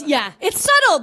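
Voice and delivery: normal talking voice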